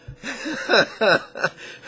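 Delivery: loud, high burst of laughter. Real, not acted. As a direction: laugh heartily at your own joke. Laughs at own mock objection.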